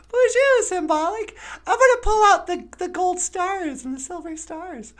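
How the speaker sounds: very high, loud cartoon puppet greeting; high puppet bonjour. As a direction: squeak it out like a bubbly puppet. Puppet character voice.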